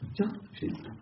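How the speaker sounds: a hushed, breathy aside to Shaitan; half-awake man whispers the name back. Retold or imitated. The sleeper to Shaitan, a startled whisper. Retold talk with Shaitan at night.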